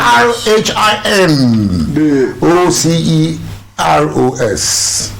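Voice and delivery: slow, letter-by-letter spelling. Shown as a demonstration. Quizmaster spelling rhinoceros for contestants, slow and deliberate. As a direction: spell it slowly, each letter stretched.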